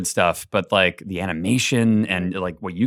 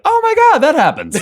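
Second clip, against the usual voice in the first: much higher and louder, thrilled surprise; excited fan gasp; 5 dB louder than usual. Retold or imitated. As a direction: gush like a fan seeing it for the first time. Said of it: "and be like".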